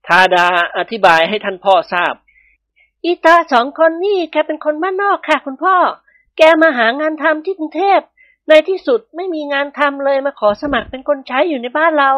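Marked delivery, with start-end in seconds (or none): 2.6-12.1 put-on young daughter. A daughter explaining the two strangers to her father, in a high polite voice. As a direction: play the well-bred daughter gently explaining.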